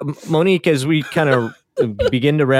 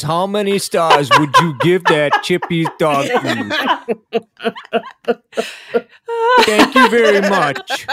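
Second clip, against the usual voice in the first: higher, slow, robotic assistant read; mock a smart speaker survey voice, laughing; a slow, sing-song imitation of a voice assistant; imitating Alexa; 5 semitones higher, slower than usual.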